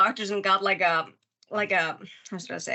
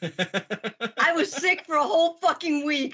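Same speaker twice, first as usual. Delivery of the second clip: higher, slow protesting whine; indignant whine; 6 semitones higher, slower than usual. Retold or imitated. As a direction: protest like a kid. Indignant retort, laughing.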